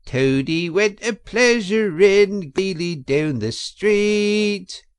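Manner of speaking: high, slow squeaky singsong; high squeaky weasel. A narrator's reading. Chief weasel singing to the banquet in a high squeaky voice. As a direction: squeak the song out mockingly. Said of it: "began in a high squeaky voice".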